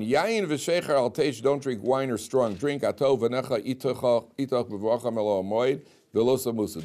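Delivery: chanting the verse to students; verse reading. Acted. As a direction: read the verse out to the class. Reads the verse aloud.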